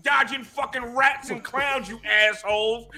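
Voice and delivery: loud gloating rant, shouting; swearing tirade. Real, not acted. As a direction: yell insults at rival fans. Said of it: "Bing Bong my ass".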